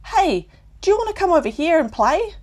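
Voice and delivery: high, loud, fast friendly call; eager young koala calling out. A narrator's reading. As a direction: call across the trees. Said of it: "a young koala called out".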